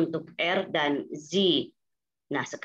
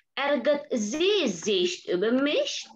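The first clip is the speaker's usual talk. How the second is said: Ärgert sie sich über mich? drawn out with a rising end; slow model question; 6 semitones higher, slower than usual. Shown as a demonstration. Teacher modelling a German question for students, slow and rising. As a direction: ask it slowly, rising to the end. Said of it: "teacher models question, repeats".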